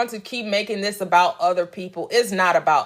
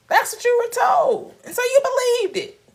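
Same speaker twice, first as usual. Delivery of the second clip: mocking high voice. Mocking what people were told.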